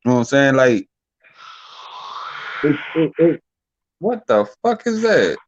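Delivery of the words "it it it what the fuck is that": sudden high startled question. Real, not acted. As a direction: jump at a strange noise. Startled by a loud snoring noise.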